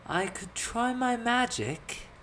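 high, slow, hesitant offer; a bit sheepish. A narrator's reading. Elizabeth offering her magic, sheepish high drawl. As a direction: venture it timidly, stretched out. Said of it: "a bit sheepishly".

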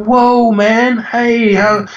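high-pitched mock American; hammed-up American drawn from the street; ridiculous American accent. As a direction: imitate an awestruck American in a silly accent. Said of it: "another ridiculous accent".

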